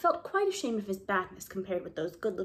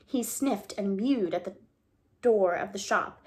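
usual speaking voice

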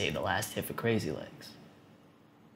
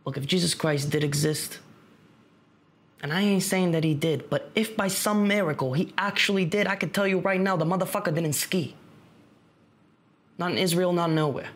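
insistent rant, higher and building in pitch; heated argument; 7 semitones higher than usual. Acted. Friend ranting about a cult leader's claims, heated. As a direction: argue it hard, voice climbing as you go.

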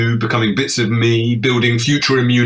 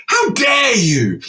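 slow shouted retort; 13 semitones higher, slower than usual. Retold or imitated. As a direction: yell it back, each word dragged. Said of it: "And I said".

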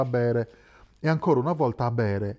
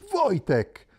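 calling by name; 7 semitones higher than usual. A narrator's reading. Andreas spots his old mining mate, glad call. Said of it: "Lui lo chiamò subito per nome".

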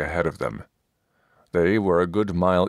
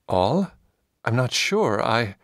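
put-on higher, hesitant young warrior; nervous young Silas; 5 semitones higher than usual. Young warrior unsure answering his master, hesitant and higher. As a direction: falter like a dim, eager lad.

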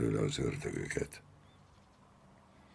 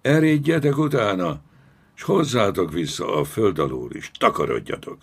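irate shouted command; angry bellow; 6 semitones higher, 5 dB louder than usual. A narrator's reading. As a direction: roar orders at cowed soldiers. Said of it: "ordította megszeppent zsoldosokra".

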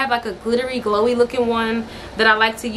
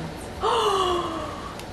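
delighted gasp; 8 semitones higher, 7 dB quieter than usual. Real, not acted. Sees the low rise jeans.

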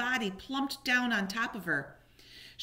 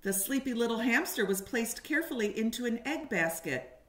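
ordinary delivery, nothing marked

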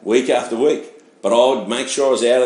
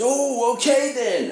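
much higher, meek backing-off voice; startled retreat; 12 semitones higher, slower than usual. Retold or imitated. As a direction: mimic a greeter suddenly intimidated and backing away. Greeter backs off after threat.

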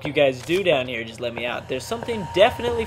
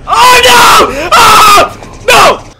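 very high, loud shriek; real fright, loud and high; terrified scream; 16 dB louder, much slower than usual. Real, not acted. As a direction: scream as the thing appears. Monster appears again.

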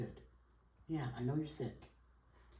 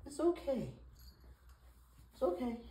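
cooing reassurance; 10 semitones higher than usual. Real, not acted. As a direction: reassure a pet in a high coo. Comforting the crying cat.

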